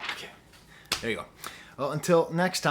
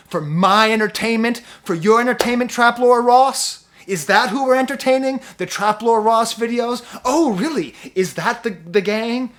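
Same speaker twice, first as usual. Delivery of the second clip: loud and high, passionate anger; heated rant; 6 semitones higher, 8 dB louder than usual. Real, not acted. Angry at rap beef, a loud, high heated rant. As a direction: rail against it, louder and higher. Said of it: "I got heated".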